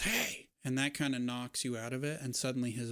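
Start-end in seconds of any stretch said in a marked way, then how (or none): 0.0-0.5 high sharp call to snap him out; sharp hey. Acted. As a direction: bark it to wake him up. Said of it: "And she says".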